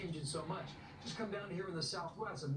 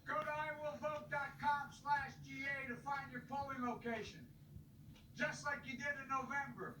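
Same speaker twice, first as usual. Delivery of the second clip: rally speech; 7 semitones higher than usual. Acted. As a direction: rouse the crowd to go vote. Impassioned rally speech to voters.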